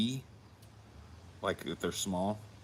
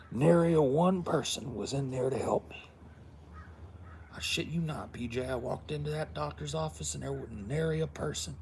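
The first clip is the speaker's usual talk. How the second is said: higher mock of mom's complaining voice; imitating mom; 8 semitones higher than usual. Son mimics his mother telling her doctor story, higher. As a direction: play mom griping about the doctor. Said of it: "a little bit of that Irish in her".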